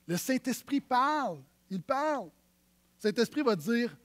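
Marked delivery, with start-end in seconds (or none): none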